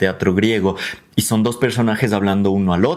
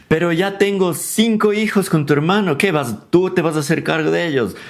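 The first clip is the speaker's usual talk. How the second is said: higher-pitched, worked-up objection; acted indignant questioning; protesting sister-in-law; 6 semitones higher than usual. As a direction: challenge the lover in a raised pitch. Acted protest of brother's wife.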